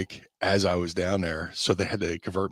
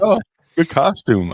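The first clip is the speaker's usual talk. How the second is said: mock bystander voice; 10 semitones higher, 10 dB louder than usual. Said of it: "They're like".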